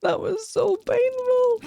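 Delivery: high, slow pained whimper; wolf voice. Pretend. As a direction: whine it like a hurt wolf pup.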